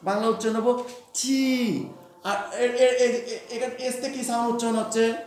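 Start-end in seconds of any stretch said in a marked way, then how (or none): 1.1-1.9 "ঠি" slowly, the "ee" held; long vowel. Shown as a demonstration. Teacher gives the Bengali spelling's sound, "ঠি" with a long "ee". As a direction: draw out "ঠি" as a long "thee". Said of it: "long বলতে কি?".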